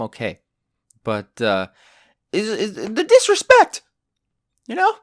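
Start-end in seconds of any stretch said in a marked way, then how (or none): 2.3-4.9 disrespect rant. Real, not acted. Ranting about parents' disrespect.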